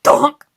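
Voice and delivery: sudden loud "donk" impact noise; imitated thud. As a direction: imitate something smacking the car window. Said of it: "out of the corner of my eye go".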